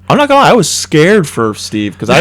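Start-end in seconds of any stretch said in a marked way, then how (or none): none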